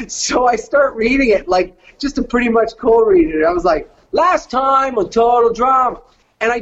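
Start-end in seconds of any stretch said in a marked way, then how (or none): 4.1-6.0 higher-pitched reality-show host announcing; obnoxious TV host. Shown as a demonstration. Cold reading the host monologue at audition, obnoxious host. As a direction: announce it like a cocky television host. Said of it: "television host for a show".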